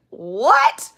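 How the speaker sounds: loud, high incredulous "what"; shocked reply. Retold or imitated. Stranded traveler reacts to cancelled buses, shocked. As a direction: blurt it out in disbelief.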